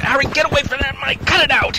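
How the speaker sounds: high, loud angry yell; angry shout. Acted. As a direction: shout him away from the mic. Puppet argument over cancelling show.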